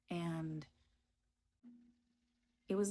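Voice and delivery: ordinary talk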